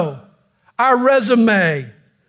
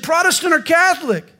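high, eager voice, much higher; eager rescuer; 9 semitones higher than usual. Acted. Rescuer on the bridge eagerly quizzes the jumper, high and keen. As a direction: pitch it up, bright and keen.

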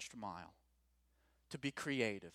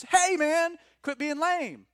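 much higher and louder, playful yell; teasing shout; 14 dB louder, slower than usual. Pretend. Preacher mocking a friend's excuses, loud playful shout. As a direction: shout the friend down, half joking.

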